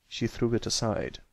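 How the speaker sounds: usual speaking voice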